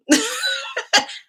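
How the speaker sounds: high burst of laughter; big laugh. Real, not acted. Host laughing after saying her name, high laugh. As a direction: crack up at your own joke.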